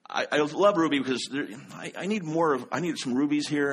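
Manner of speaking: ordinary delivery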